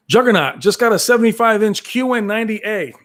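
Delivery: normal talking voice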